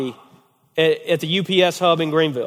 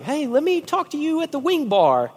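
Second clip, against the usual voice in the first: much higher, lightly voiced friend pulling him aside; mock Josh, pitched way up and eager; Josh's eager voice; 11 semitones higher than usual. Josh calling him over to the wing bar, high and eager. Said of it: "So Josh is like".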